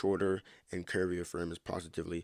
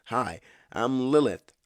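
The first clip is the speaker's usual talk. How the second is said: bright friendly intro; 5 semitones higher, 8 dB louder, slower than usual. Acted. Lilith introduces herself.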